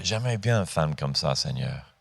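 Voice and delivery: low, soft aside to God; quiet prayer. Retold or imitated. Young man confiding a wish to God, quiet retold prayer.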